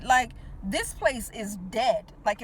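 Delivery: ordinary delivery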